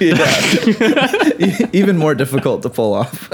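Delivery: high, laughing through the words; can barely get it out for laughing. Real, not acted. To co-host after the double feature joke, laughing high.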